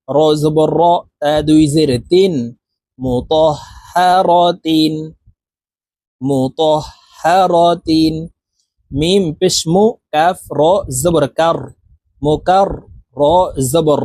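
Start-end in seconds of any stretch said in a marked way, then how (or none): 3.0-8.1 drawn-out Quranic recitation, syllable by syllable; slow model recitation. Shown as a demonstration. Teacher reciting the assembled word for learners, slow chant. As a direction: stretch each syllable evenly, half-chanted.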